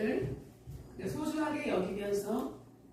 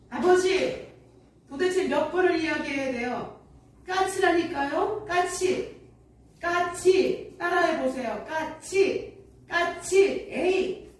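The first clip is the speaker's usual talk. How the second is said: higher, louder exasperated shouting at father; angry outburst; 5 semitones higher, 4 dB louder, slower than usual. Acted. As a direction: snap at him, fed up with repeating. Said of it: "버럭 소리를 지르고 말았습니다".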